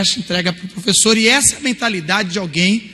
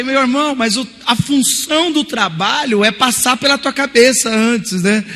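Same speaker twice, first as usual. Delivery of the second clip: higher, louder exasperated rebuke; exasperated scolding; 6 semitones higher, 4 dB louder than usual. Retold or imitated. Teacher scolding student for copying, loud and exasperated. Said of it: "Aí eu falei".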